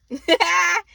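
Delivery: short, loud high laugh. Real, not acted. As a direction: burst out in one high cackle. Own laugh at the reading.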